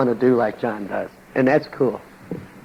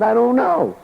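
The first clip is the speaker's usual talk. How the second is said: helpless I don't know; 10 semitones higher than usual. Retold or imitated. Helpless answer repeated.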